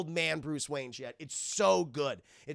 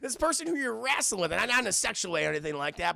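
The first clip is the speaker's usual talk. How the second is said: ordinary delivery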